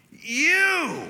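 high loud accusing cry; wife blaming him, loud and high; imitated wife's outburst. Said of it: "And she goes".

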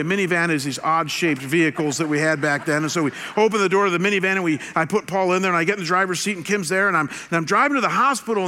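ordinary talk

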